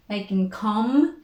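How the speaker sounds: normal talking voice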